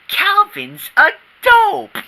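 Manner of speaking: slow, high mocking drawl of the name; taunting sneer. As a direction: spell out the insult slowly to needle him.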